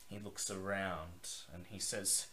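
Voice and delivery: ordinary delivery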